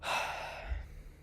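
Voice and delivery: long, soft exhale of frustration; heavy sigh. Real, not acted. Fan angry at how the team played, sighing heavily.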